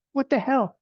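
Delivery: high startled yelp; a retold yelp after a sharp elbow. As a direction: jolt up as if suddenly elbowed. Elbowed hard by his wife.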